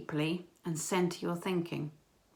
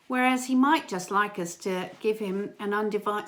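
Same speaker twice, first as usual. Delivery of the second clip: usual speaking voice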